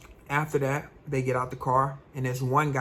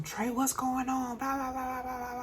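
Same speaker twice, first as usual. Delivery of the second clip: much higher, soft mock mom chatter; mama nagging; 10 semitones higher, 7 dB quieter than usual. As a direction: rattle it off like a fussing mother. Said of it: "She talking about some".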